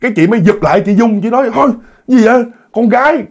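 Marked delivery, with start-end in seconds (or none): 1.5-3.2 high-pitched startled objection; alarmed protest. Acted. Pregnant woman pulling away from demons, alarmed protest. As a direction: protest urgently, pitch jumping up.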